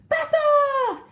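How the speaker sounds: high, loud blast-off cry; launch shout. Acted. Rocket launch voice.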